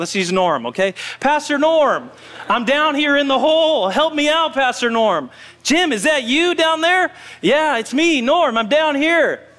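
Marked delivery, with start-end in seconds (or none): 1.2-5.3 calling for help. Acted. Jim calls up to his pastor from the hole, mock pleading. 5.6-7.1 high, acted call down a hole; surprised calling. Pastor discovers Jim in the manhole, mock surprised call. 7.4-9.5 high acted answering call. Jim answers his pastor from the hole, calling up.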